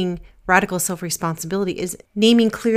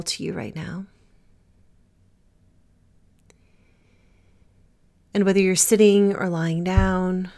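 guided meditation. Acted. Leads a breathing meditation.

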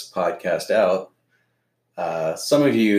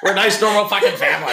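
laughing; 12 semitones higher, 4 dB louder, faster than usual. Real, not acted. Family joke, laughing through it.

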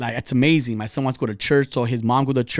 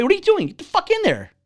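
much higher-pitched retold telling-off; exasperated scolding. Dad showing how kids get checked, exasperated scolding. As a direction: scold the kid in disbelief. Acted scolding of his son.

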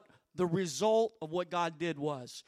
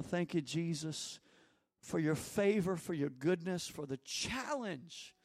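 worship prayer; 4 dB louder than usual. Acted. Leads prayer over music.

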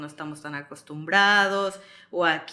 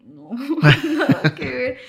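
laughing denial; 6 semitones higher, 6 dB louder, slower than usual. Retold or imitated. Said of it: "y yo".

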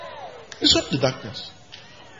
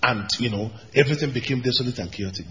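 ordinary delivery

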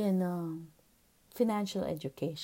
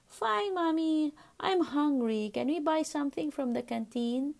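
put-on little boy voice; 6 semitones higher than usual. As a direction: play the small son asking sweetly. Mother voices her young son.

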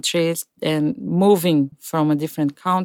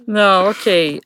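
usual speaking voice

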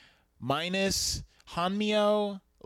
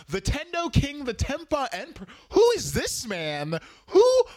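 higher and louder, building to a yelped Who; baffled outburst; 10 semitones higher, 6 dB louder than usual. Retold or imitated. Voicing the confused viewer at the reveal, building baffled yelp. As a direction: sputter in growing disbelief.

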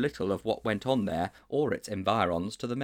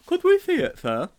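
high and loud, pleading schoolboy; boy begging his teacher, high and loud; eager boy; 9 dB louder than usual. A narrator's reading. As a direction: jump at the chance to see it. Sponge eager request.

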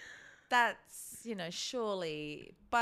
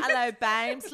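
put-on British accent; 3 semitones higher, 12 dB louder than usual. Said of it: "you were giving, like".

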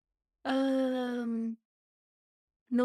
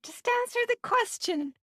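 exasperated quote; 14 semitones higher, 6 dB louder than usual. Retold or imitated. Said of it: "we were like".

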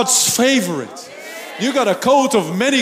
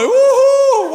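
a high, loud whoop; mock cheer; 9 dB louder than usual. Preacher acting thrilled at a new trial, whooping. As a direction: whoop like you won a prize.

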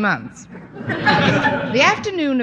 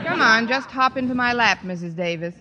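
ordinary talk

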